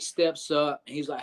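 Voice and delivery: normal talking voice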